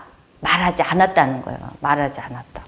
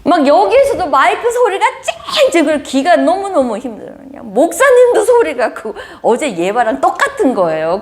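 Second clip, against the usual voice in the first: laughing; 8 semitones higher than usual. Real, not acted. Laughing confession of her irritation.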